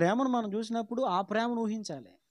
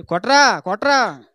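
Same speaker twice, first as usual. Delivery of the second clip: mother's command; 10 dB louder, slower than usual. Retold or imitated. Mother at family prayer ordering her son to clap, loud command. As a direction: call out sharply for clapping.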